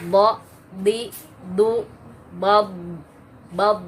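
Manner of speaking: heavy full-mouth bo di du bab; isti'la demo, loud and thick; thick heavy letter demo. Shown as a demonstration. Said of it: "ditahan gitu ya".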